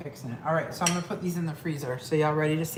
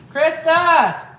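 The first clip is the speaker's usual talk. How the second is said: calling out; 11 semitones higher, 12 dB louder than usual. Real, not acted. Calling her daughter.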